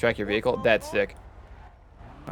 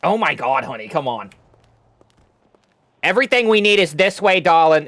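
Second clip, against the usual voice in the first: put-on higher, louder New York old lady calling out; New York accent; 4 semitones higher, 4 dB louder than usual. [name] coaxing her friends along in a New York accent. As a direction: sweep them along like a brassy New York grandma. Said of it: "Is that accent New York?".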